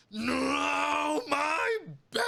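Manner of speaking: high, loud anguished shriek; blood-curdling scream. Acted. Chaz screaming at the mess on his bed. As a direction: scream it from the gut. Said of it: "you hear a visceral scream".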